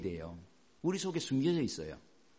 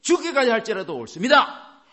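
high, loud indignant talking back; defiant retort; 7 semitones higher, 9 dB louder than usual. Acted. Jonah answering God, defiant and loud. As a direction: fire back at God, stubborn and hot. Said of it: "하시니 그가 대답하되".

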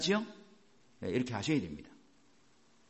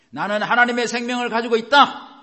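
high, shouted faith declaration; loud confession; 9 semitones higher, 12 dB louder than usual. Shown as a demonstration. Preacher leading congregation in a confession, loud and forceful. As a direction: declare it boldly, voice raised high. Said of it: "이렇게 고백하는 거예요".

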